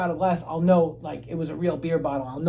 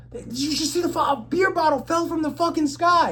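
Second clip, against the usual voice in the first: high-pitched, disbelieving outburst, louder as it goes; incredulous insisting; 12 semitones higher, 5 dB louder than usual. Retold or imitated. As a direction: freak out as if nobody believes you. Insists he saw bottle fall.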